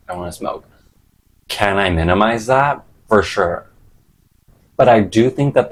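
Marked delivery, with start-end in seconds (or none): 0.1-0.6 whispered. Retold or imitated. Quoting own craving.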